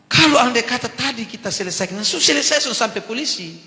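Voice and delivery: high, loud exasperated rant; inner rant. Retold or imitated. Pastor rants silently at the old man, high and loud. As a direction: vent the anger you held inside. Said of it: "Saya dalam hati".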